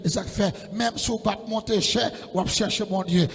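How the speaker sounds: loud, rising sermon climax; full-force preaching. Real, not acted. Preacher urging the congregation to seek God, loudly.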